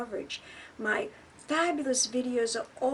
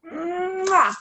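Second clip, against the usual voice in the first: loud smacking air kiss; 7 semitones higher, 11 dB louder than usual. Acted. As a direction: blow a big kiss.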